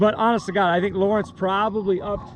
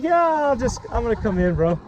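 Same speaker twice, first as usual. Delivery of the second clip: voicing the fish; 6 semitones higher than usual. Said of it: "He's like".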